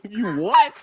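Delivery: high, loud, laughing shout. Real, not acted. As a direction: burst out laughing mid-question. Laughing through the line.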